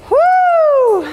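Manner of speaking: high whooping cheer; excited woo. Real, not acted. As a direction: whoop to pump up the class. Instructor cheers during workout.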